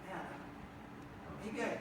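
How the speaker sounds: quietly second-guess yourself; hushed doubtful musing. Retold or imitated. Wondering if dose was enough.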